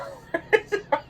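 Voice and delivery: a breathy, high burst of laughter; airy laugh. Real, not acted. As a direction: laugh at the memory. Laughing at own bargain story.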